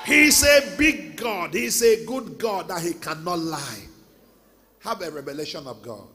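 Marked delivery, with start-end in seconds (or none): 0.0-1.5 He's a big God, each word slow, loud and drawn out; emphatic big God. Acted. Echoes earlier big God line. 1.5-3.8 loud, booming sermon climax; preaching at full force. Acted.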